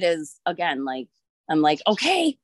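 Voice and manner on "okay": high, upbeat self-pep talk; determined resolve. Retold or imitated. Retelling her plan after the birth, a high determined self-pep talk. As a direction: talk yourself into it, chin up.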